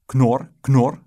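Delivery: loud, punched pig grunts; playful loud "knor" imitation; pig oinking. A narrator's reading. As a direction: call out two oinks like a child playing pig.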